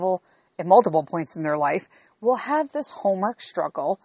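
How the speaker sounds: normal talking voice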